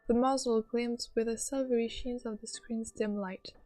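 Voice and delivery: story narration. Acted. Reads game narration.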